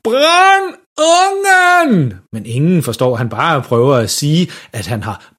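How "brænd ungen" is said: very slow, high garbled bellow; råb for fuld hals. A narrator's reading. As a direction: stretch each word into a desperate yell. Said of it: "råber stadigvæk for fuld hals". (langtrukket skrig for fuld hals)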